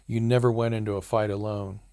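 usual speaking voice

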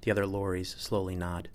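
plain reading voice

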